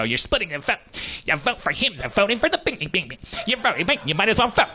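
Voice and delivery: high, nagging mock friend voice. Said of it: "sounded a little bit like Tay Brixton".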